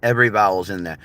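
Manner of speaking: ordinary talk, nothing marked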